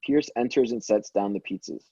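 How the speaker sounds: normal talking voice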